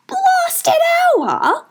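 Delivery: very high, screechy squawk. A narrator's reading. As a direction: squawk the words back like a bird. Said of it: "Jaggle squawked".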